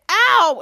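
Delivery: loud high ow; cartoon zap pain; mock ow. Zapped during the fight, a loud ow. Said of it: "he said".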